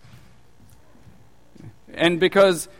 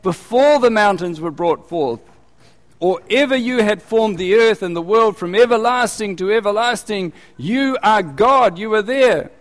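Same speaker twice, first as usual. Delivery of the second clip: psalm repeated louder and higher, with force; emphatic louder reread; 5 semitones higher, 5 dB louder than usual. Acted. Preacher repeats the psalm to the congregation, louder and emphatic. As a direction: press the psalm home, louder. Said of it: "Get that?".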